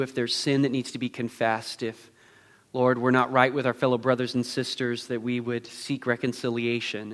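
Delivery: low, soft, reverent prayer. Acted. Pastor leading church before communion, hushed prayer. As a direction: pray quietly on the congregation's behalf.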